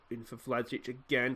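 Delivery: normal talking voice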